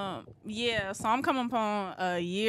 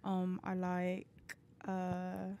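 soft, measured put-on British accent; 9 dB quieter than usual. Shown as a demonstration. Guest demonstrates her British accent for the hosts, British accent. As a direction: introduce yourself in a careful British accent. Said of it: "let me hear the British accent".

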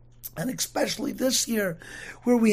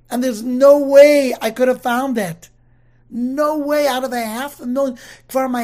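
amazed disbelief; 3 semitones higher, 7 dB louder than usual. Real, not acted. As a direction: marvel that it could be found. Amazed at finding the grave.

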